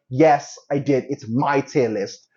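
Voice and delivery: ordinary talk, nothing marked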